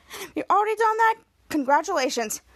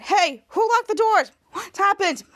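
raised, slower indignant question; mock alarmed demand; 5 semitones higher, 4 dB louder than usual. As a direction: act out startled questioning. Acted startled demand in recap.

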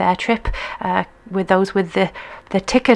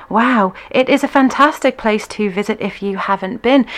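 usual speaking voice

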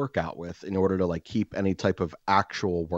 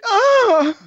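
very high, very loud fake moaning yell on a punch; fake orgasm yell; 13 dB louder than usual. Punching the heavy bag to annoy neighbours, loud high fake orgasm yell. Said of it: "as loud as I can with every single punch".

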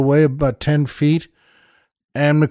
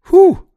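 high loud whew; 8 dB louder than usual. Real, not acted. Marvels at getting new car.

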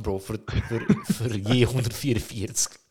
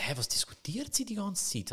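whispered and a bit higher, confused; puzzled whisper; 8 semitones higher, 5 dB quieter than usual. Retold or imitated. Whispering to himself, baffled by his girlfriend's haggling. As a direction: hush it like a baffled aside.

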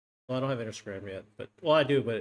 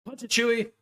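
loud Chewy line; 8 semitones higher than usual. Retold or imitated. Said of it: "Just, just be loud".

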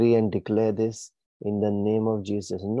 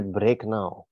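normal talking voice

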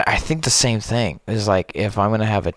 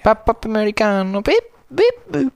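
silly high voice; 13 semitones higher, 3 dB louder than usual. Pretend. Silly high sing-song.

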